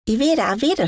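high-pitched call at normal volume; lightly acted shout of a request; mock children's shout. A narrator's reading. Children choosing their story, mildly shouting in high pitch. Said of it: "schrien einige".

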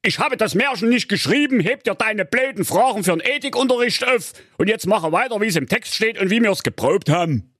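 hohe, aufgebrachte Königsstimme; wütender König. Acted. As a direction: schimpfender Märchenkönig, der seine Tochter zurechtweist. König weist Tochter zurecht. (der König schimpft empört mit seiner Tochter)

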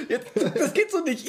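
laughing, high-pitched protest. Real, not acted.

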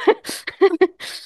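high, embarrassed laugh; nervous laugh. Real, not acted. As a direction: laugh off the emotion. Embarrassed after admitting emotion. (nervöses Lachen)

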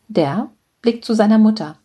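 ordinary delivery

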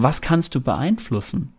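usual speaking voice

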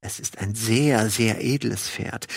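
softly and slowly, weighing each word; careful, quiet. A narrator's reading. Randall warily answers his neighbour, quiet and slow. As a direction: tread carefully, voice dropped low. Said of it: "Vorsichtig sagte er".

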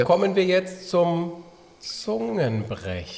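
drawn-out announcement. Pretend. Announcing the tongue twister segment.

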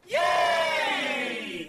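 high, drawn-out cheer. A narrator's reading. Friends cheering as the hare gets through, high soft cheer.

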